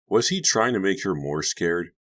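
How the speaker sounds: ordinary delivery, nothing marked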